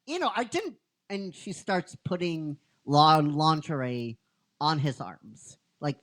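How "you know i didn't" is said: flustered protest. Retold or imitated. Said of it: "he's like".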